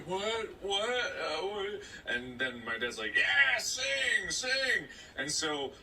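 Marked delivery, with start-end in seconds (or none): 0.1-1.8 small, high, flustered stammer; bewildered child. Retold or imitated. Child suddenly told to sing, high and bewildered. Retells his bewildered reaction. 3.2-4.8 high, loud egging-on; insistent dad. Retold or imitated. Dad pushing his kid to sing, loud and insistent. Retells dad urging him.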